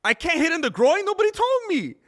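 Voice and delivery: high, loud mock-innocent voice; imitating Xiao, feigning surprise; mock confused fighter. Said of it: "Xiao was saying".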